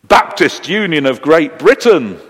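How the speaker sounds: proud, loud declaration; firm, punched-out proud reply. Acted. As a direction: announce it with acted pride. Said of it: "the potential jumper said proudly".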